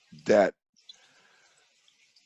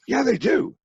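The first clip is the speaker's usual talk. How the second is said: high, blunt yeah, they do; mock dad snapping back; insistent retort. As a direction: shut her down flatly. Dad insisting in retold argument.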